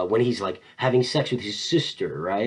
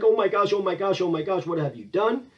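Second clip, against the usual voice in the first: higher, repetitive mock panic; hand-wringing fretting; 5 semitones higher than usual. Gertrude to Hamlet after the killing, hand-wringing fretting. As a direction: fret as if wringing your hands. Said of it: "Gertrude is wringing her hands".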